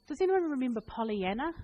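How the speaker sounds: ordinary talk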